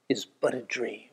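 put-on soft wistful old woman; dreamy grandma. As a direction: drift off like a confused grandmother. Voicing senile grandma's refrain.

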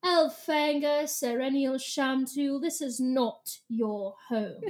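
very slow, stately British pronouncement; snooty, measured English accent. Acted. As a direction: draw it out in a lofty British accent. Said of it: "I like snooty British Ellimist".

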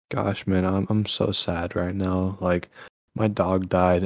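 showing a sad mood before switching; mock sad. Shown as a demonstration. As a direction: sulk through it. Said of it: "I could be like".

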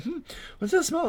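high, puzzled mock question; imitating diners puzzled by a smell; mock curious sniff. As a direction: wonder aloud at a smell. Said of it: "they're just like".

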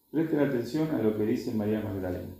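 usual speaking voice

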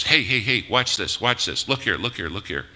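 loud, high, rapid calls for attention; acting out an insistent world calling you to look; urgent attention-grabbing. The world grabbing at listeners' eyes, urgent and loud. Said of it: "That's why the world says".

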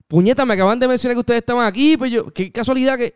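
high-pitched, amazed outburst; retold excitement. Stranger just told the band was here, high and excited.

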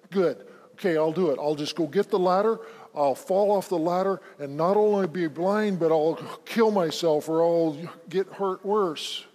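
loud, bitter mock agreement, slowing at the end; angry sarcasm. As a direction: spit it out in resentful defiance. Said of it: "His anger".

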